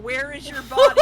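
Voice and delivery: retold yell. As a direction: yell it at the kid, laughing. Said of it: "just kept yelling".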